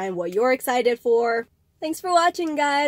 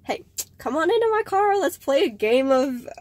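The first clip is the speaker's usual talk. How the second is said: high, eager invitation; acted sociable owner, high and inviting; social Tesla owner; 6 semitones higher than usual. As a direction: pitch it up like an over-friendly stranger. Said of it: "this one social Tesla owner who's gonna be like".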